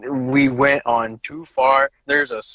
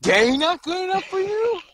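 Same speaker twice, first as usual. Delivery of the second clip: high mock outrage; mock indignant squeak. Mock indignant rant continues.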